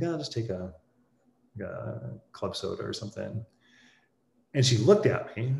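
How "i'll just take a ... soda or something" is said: quiet, light voice with no chest in it; soft, unmanly voice. Retold or imitated. Tired traveler orders from the stewardess, quietly and meekly. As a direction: ask meekly and softly. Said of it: "Why don't you use your manly voice?".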